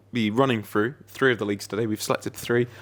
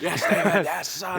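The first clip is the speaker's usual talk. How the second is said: mock touchline cheer; 9 semitones higher, slower than usual. Mocking Jack cheering at Moyes.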